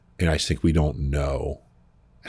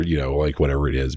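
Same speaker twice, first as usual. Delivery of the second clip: ordinary talk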